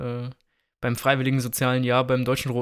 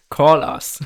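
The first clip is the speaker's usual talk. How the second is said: laughing; 4 semitones higher, 4 dB louder than usual. Pretend. Joking call to a friend.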